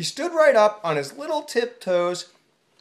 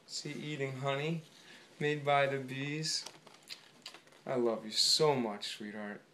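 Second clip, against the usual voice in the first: soft aside; 6 semitones lower, 10 dB quieter than usual. Real, not acted.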